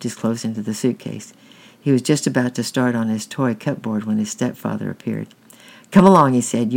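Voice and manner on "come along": louder, higher sharp call; brisk command. A narrator's reading. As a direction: hurry the child along loudly. Stepfather hurries boy away from toys.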